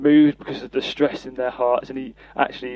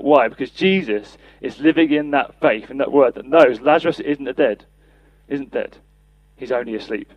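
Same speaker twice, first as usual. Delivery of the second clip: ordinary delivery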